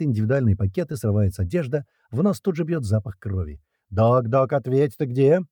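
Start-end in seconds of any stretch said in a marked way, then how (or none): 3.9-5.4 urgent call, rising question; calling by radio. A narrator's reading. Fighter calling the doc by radio, urgent. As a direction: try to raise a silent comrade. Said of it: "Пытается вызвать его по рации".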